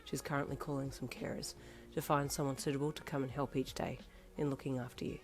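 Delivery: soft, quick comforting; tender promise. Acted. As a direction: promise him gently. Boyfriend comforts old man.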